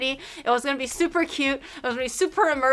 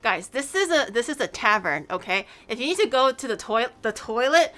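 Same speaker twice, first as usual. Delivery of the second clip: ordinary delivery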